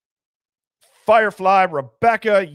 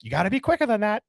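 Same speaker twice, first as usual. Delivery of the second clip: quick, raised-pitch imitation of an ad line; commercial catchphrase; 6 semitones higher, faster than usual. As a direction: mimic the commercial's teasing tagline. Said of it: "they would just be like".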